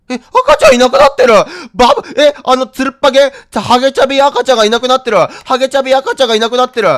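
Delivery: high alarmed exclaim; shocked cry. Real, not acted. Baby vanished in game.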